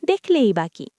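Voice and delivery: usual speaking voice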